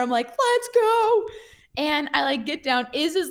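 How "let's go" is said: high, drawn-out victory cheer; retold triumph. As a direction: re-enact celebrating a landed jump. Celebrating landed jump, retold.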